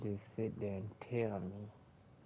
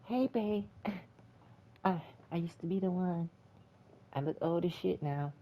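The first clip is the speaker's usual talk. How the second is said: higher, whiny mock Neo voice; 5 semitones higher than usual. Reviewer mocking Neo greeting Trinity, in a higher whiny voice. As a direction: ham up a feeble washed-up hero. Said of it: "Neo walks through the door like a little bitch".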